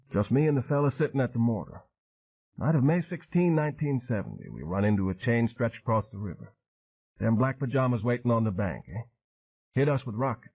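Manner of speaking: put-on matter-of-fact old sailor recalling the ambush; weathered Cajun veteran. Train telling Dan how his crew died, voiced.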